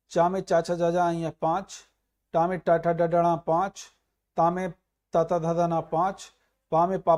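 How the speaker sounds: usual speaking voice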